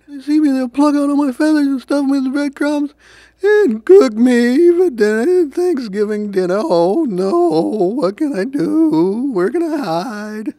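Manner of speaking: high, loud, wailing panic; turkey in a tizzy. A narrator's reading. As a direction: fret wildly as a hunted turkey. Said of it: "a turkey was in a tizzy".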